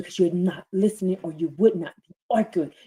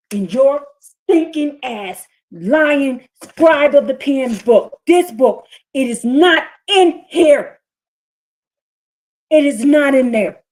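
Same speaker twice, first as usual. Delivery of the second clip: slow, loud, higher scornful tirade; angry rant; 7 semitones higher, 8 dB louder, slower than usual. Real, not acted. Preacher furious at the scribes' book, slow and loud. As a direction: spit contempt at the lying book.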